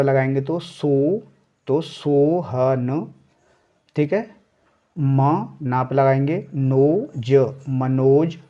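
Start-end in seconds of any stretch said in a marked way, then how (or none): 5.0-7.5 spelling out. Shown as a demonstration. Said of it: "spelling out Manoj".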